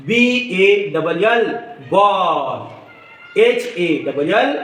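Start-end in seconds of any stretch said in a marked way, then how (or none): 1.9-2.6 "ball" with a long aw vowel; open aw sound. Shown as a demonstration. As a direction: model "ball", stretching the aw. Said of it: "ए चा उच्चार अ असा करायचा". ("ball" मधला ऑ लांबवून)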